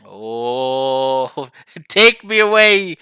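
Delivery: laughing take me away. Real, not acted. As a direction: laugh through a swoon. Laughing at hooded visitors.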